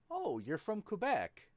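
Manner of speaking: high bright mock asking; a lightly voiced eager tourist; mock naive question. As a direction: play the clueless outsider guessing. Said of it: "And I'm like".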